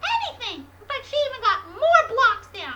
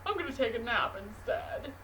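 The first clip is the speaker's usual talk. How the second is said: lazy dog voice; 10 semitones lower, 6 dB quieter than usual. Acted. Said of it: "And the dog said".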